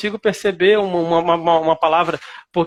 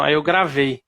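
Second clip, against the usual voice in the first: ordinary delivery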